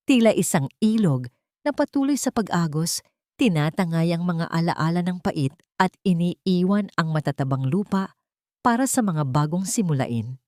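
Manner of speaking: ordinary delivery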